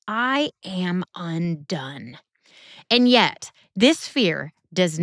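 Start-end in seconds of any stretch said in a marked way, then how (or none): 0.1-2.2 slow, soft and broken, almost spoken to himself; hushed and slow. Acted. Preacher voicing Isaiah's awe before God, hushed and slow. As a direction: let the words sink, barely above a breath. Isaiah's cry after "he says.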